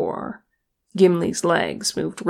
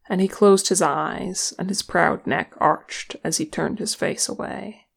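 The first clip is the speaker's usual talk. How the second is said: ordinary delivery, nothing marked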